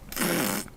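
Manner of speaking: short mocking raspberry bursts; blowing raspberries. Merrick taunting the pharaoh, blowing raspberries. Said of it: "Merrick just starts doing raspberries".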